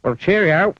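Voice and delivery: high, loud jaunty cheerio; high chirpy cheerio; cheery goodbye. Acted. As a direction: wave him off brightly. Clumsy saying goodbye.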